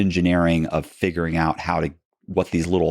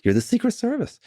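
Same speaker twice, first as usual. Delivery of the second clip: mock disbelief. Mock incredulity at agents.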